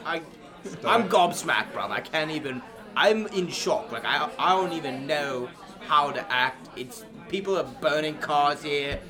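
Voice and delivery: a heartbroken London fan after penalties; hammed-up British lad venting about the loss; mock gutted English fan.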